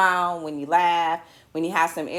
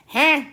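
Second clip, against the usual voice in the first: loud, high playful "huh" as a sound effect; mock sound effect; 8 semitones higher, 7 dB louder than usual. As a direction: toss it out like a jingle. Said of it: "My sound effect".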